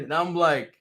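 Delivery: normal talking voice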